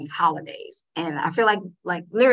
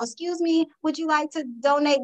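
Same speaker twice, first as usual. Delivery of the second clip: put-on high, sweet little-girl voice; a toddler politely stopping shoppers, pitched high; little daughter's voice; 7 semitones higher than usual. Voicing three-year-old daughter.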